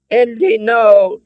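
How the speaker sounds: high, loud, drawn-out cry; begging. A narrator's reading. Captive woman to Andy, begging loudly. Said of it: "Elsa begged".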